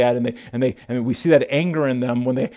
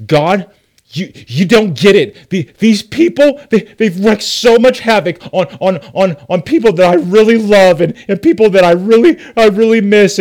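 loud, higher, pained protest; anguished anger; 5 semitones higher, 8 dB louder than usual. Retold or imitated. Jonah venting pain at God, loud and anguished. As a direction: pour out hurt and fury at God. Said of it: "he's like".

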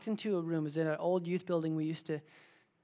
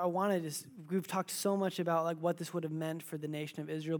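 usual speaking voice

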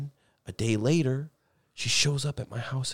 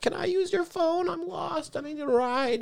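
put-on much higher, small lost girl voice; high girly voice; slower than usual. Imitating the lost girl at his door in a high voice. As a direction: play the lost crying girl asking for help.

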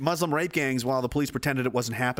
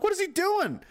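much higher baffled question; mock baffled. Neighbor watching from the window, baffled. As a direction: wonder aloud in disbelief.

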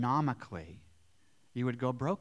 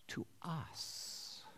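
resentful whisper; 2 semitones higher, 16 dB quieter than usual. Acted. Grumbling workers quoted.

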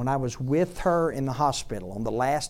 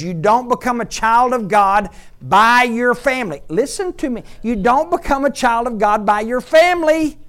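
loud, high preacher's cry, pounding each point; fiery sermon delivery; preaching at full force; 6 semitones higher, 8 dB louder than usual. Acted. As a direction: preach it hard to the whole room.

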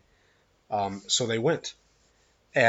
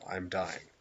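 low, much softer retelling; quoted last words; 5 semitones lower, 12 dB quieter than usual. Retold or imitated. Host repeating a dying man's last words, quiet and subdued. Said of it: "His last words were".

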